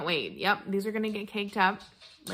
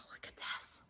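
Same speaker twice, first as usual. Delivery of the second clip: barely voiced, stunned whisper; awestruck whisper; 18 dB quieter than usual. Real, not acted. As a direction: whisper it, amazed by the gift. Showing the surprise gift, overcome.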